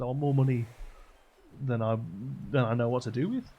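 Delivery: loud, eager wishing; hammed-up greedy man getting carried away; greedy demand. Greedy man escalates his wish.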